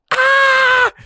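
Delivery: startled scream. Retold or imitated. Scared behind the door.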